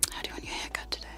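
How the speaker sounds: normal talking voice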